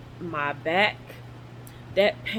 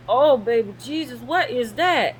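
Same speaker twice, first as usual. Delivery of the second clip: higher, louder alarmed cry; startled outcry; 6 semitones higher, 5 dB louder than usual. Retold or imitated. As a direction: jolt of alarm at a strange feeling. Shocked by sudden feeling.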